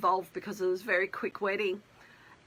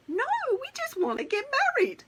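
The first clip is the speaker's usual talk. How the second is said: protesting couple; 3 dB louder, faster than usual. Retold or imitated. Couple answering the pregnancy question.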